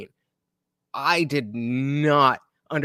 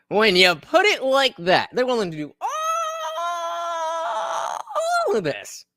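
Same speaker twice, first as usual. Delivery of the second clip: excited realization. Real, not acted.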